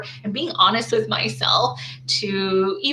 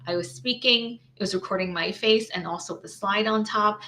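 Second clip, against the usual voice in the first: ordinary talk, nothing marked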